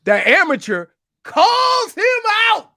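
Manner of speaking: high, very loud mock outburst; exaggerated amateur complainer's shout; shouted call-out. Shown as a demonstration. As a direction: belt it out, far above talking pitch.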